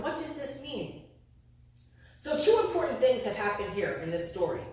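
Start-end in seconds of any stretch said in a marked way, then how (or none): 0.0-1.0 soft, acted pondering question; imitating a mother's private puzzlement; quiet wondering. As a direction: wonder it half to yourself. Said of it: "She's wondering".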